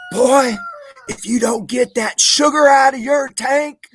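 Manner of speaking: high, loud, slow bellow of a mocking sergeant; drill sergeant bellow. Drill sergeant screaming behind a recruit while marching, loud high bellow. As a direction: roar it down the marching line. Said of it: "he said".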